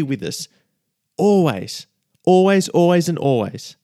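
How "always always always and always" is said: rising, louder and louder repeats; getting too excited. Real, not acted. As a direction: let the joy build on each always. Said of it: "I'm getting too excited".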